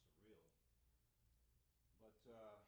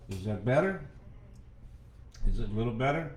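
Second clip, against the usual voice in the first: close-miked and loud; leaning into the mic; 2 semitones higher, 24 dB louder than usual. Real, not acted. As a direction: mouth right on the mic. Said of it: "lean into the mic".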